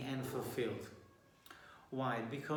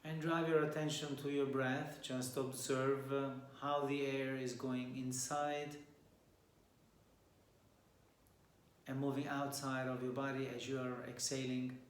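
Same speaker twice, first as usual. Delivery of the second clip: softer and softer as the breathing cues come; guided meditation; 4 dB quieter than usual. Acted. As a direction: gently talk the group into stillness.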